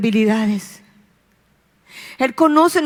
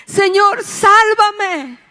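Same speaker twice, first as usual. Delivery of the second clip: gritando; 7 semitones higher, much slower than usual. Acted. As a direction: call out like a drowning man. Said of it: "Y le empezó a gritar". (grito desesperado pidiendo auxilio)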